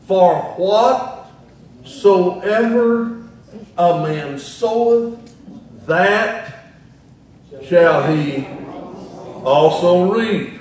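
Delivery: slow, loud preacher quoting scripture; ringing, deliberate Bible recitation; emphatic scripture proclamation. Real, not acted. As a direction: proclaim it phrase by phrase, with weighty pauses.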